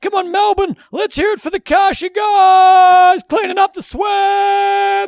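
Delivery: loud, high, slow drawn-out MC shout; milk it like an arena announcer; rev up guy. Retold or imitated. Mocking the hype man during a timeout, long MC shout. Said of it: "He's going".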